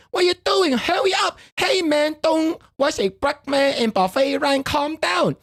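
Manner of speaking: high, urgent movie-character voice; imitating Jackie Chan. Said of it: "And then Jackie Chan say".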